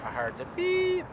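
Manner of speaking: ordinary delivery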